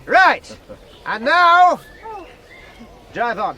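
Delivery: shouted, high start order; loud command. Acted. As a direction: send the wagons off with a shout. Schoolmaster starts the wagons off.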